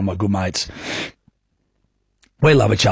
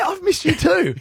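sheepish laughing reply. Retold or imitated. Sheepish reply to angry wife.

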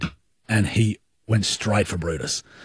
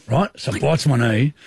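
normal talking voice

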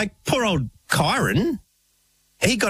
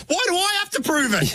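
indignant; 11 semitones higher than usual. Retold or imitated. Kyron's indignant retort.

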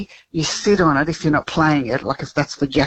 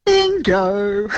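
bingo cheer; 4 semitones higher than usual. Real, not acted. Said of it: "what are you cheering for".

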